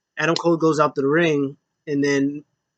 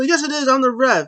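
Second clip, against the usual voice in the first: indignant ref; 9 semitones higher, 3 dB louder than usual. Retold or imitated.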